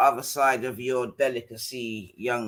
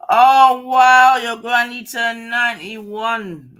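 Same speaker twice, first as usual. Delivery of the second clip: high, loud wow, easing into slow wonder; delighted amazement; 6 semitones higher, 10 dB louder, slower than usual. Real, not acted. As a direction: burst out thrilled at the news. Delighted at news of granny's birthday.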